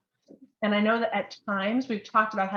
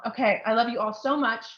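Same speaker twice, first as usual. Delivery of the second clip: usual speaking voice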